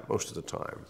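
breathy whispered echo of the phrase, most of the time; soft unvoiced aside; whispered repeat. Shown as a demonstration.

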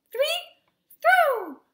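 high, loud count-off; starting shout. A narrator's reading. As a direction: shout the go signal. Starting a throwing game.

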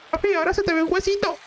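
put-on high, fast whiny kid voice; son voice. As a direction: play the complaining little boy.

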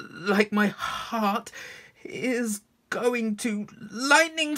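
high, stammering, hammed-up nerves; nervous voice. Shown as a demonstration. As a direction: stutter and stretch the first sounds in mock panic. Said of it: "I'll try nervous next".

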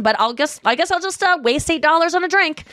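pouty self-pitying jab; sulky attitude. Retold or imitated. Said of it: "had that, like, tude of".

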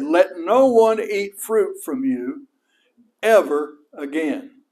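slow, loud pronouncement; loud curse. Retold or imitated. Jesus cursing the fig tree so the disciples hear, slow and loud. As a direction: declare the curse slowly for all to hear. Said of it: "he said it loud enough that his disciples heard it".

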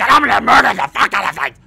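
fast, loud battle shout; hyped-up threat. Pretend. Gamer vowing to murder everything, hyped-up shout. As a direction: yell it in a rush. Hyped-up rant before killing demons.

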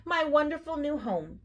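high, delighted with a smile; beaming. A narrator's reading. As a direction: marvel happily, voice lifted. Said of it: "beamed Badger".

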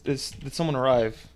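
usual speaking voice

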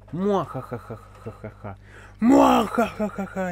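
hammed-up villain cackle; evil laugh. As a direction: cackle like a cartoon baddie. Playing villain after winning a fight.

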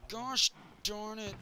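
high, loud wail of frustration over ruined crops; frustrated outburst. Real, not acted. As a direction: yelp and groan as it all goes wrong. Water flows over crops.

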